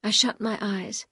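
plain reading voice

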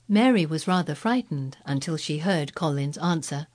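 ordinary delivery

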